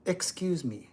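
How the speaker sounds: quiet courteous request for attention; polite and soft. Shown as a demonstration. [name] showing strangers the polite greeting, soft and courteous. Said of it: "the only acceptable way to greet".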